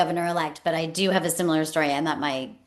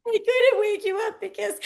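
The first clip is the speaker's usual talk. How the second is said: much higher, choked-up pleading; pleading mom. Retold or imitated. Mother explaining to her crying daughter, high and pleading. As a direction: beg her to understand through tears. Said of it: "I'm like".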